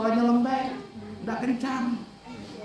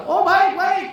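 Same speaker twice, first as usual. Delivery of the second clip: shouted reply, much higher and louder; too-loud reply; 9 semitones higher, 5 dB louder than usual. Retold or imitated. Person with headphones replying far too loud. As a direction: answer as if wearing headphones. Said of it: "kenceng banget suaranya".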